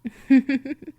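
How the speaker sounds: a short, amused vampire laugh; teasing chuckle. Acted. Vampire mistress mocking her captive, a teasing chuckle. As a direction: chuckle at your squirming prey.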